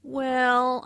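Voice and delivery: buy time with a long "Well"; drawn-out WELL. Acted. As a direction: stretch the vowel in "Well" on a held pitch. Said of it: "Notice how the vowel is drawn out".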